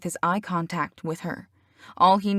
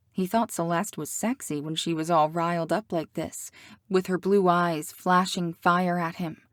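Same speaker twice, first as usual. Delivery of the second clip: usual speaking voice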